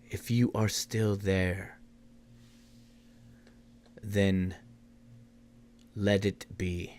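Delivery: low, soft and slow, in halting pieces; weighty words with long pauses between; broken-English speaker. Acted. Game master voices a man addressing the possessed player, halting broken English. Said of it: "he says something in very broken English".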